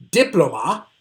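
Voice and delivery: Diploma wrongly stressed as DIP-lo-ma; stress on first syllable. Shown as a demonstration. Teacher offers first option to students, Diploma stressed on DIP. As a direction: hit the DIP of Diploma, questioning lilt. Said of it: "I'm gonna read each of them for you".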